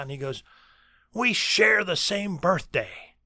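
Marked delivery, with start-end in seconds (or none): 1.1-3.2 high, drawn-out announcement; imitated excited professor, slow and high; emphatic professor. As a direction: proclaim it like an over-passionate professor. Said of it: "comes walking towards me emphatically".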